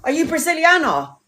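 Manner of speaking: high, loud gushing fan; excited fan. Retold or imitated. Stranger on the street spotting her, gushing excitedly. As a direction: gush like a starstruck stranger. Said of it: "people are going".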